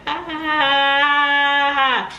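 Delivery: loud, sustained high-pitched scream; long high scream. Retold or imitated. New mom acting out screaming while pushing, long high scream. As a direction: scream it out on one held note. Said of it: "And I'm screaming like".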